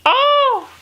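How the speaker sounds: a loud high cry of frustration; anguished wail. Real, not acted. Vlogger lamenting the couple can't be together, loud high wail. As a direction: wail it out in mock despair.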